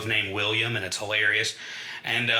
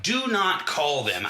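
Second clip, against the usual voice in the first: "Do not" slowed and higher, each word weighted; firm order; 5 semitones higher, slower than usual. Retold or imitated. Son stopping his mother from dialing, firm slow order. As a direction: hit "Do not" hard and slow.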